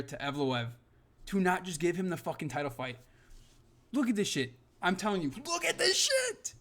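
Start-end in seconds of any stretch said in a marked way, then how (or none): 5.5-6.5 much higher, slow exasperated whine; a high, drawn-out cry of disbelief; incredulous. Real, not acted. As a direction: squeak it out in disbelief. Repeats line, second much higher.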